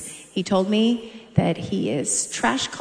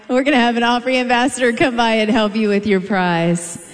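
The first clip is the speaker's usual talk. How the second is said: ordinary delivery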